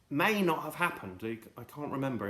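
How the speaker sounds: normal talking voice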